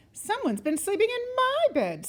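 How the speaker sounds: high-pitched Mama Bear complaint; mild growl, pitched well up; middle-sized growl. A narrator's reading. Mama Bear finds her bed slept in, high mild growl. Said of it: "middle-sized growl".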